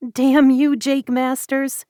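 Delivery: a cry of loving frustration that trails into a slow name; exasperated outburst. Acted. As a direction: burst out in happy exasperation. Joyful exasperated outburst at proposal.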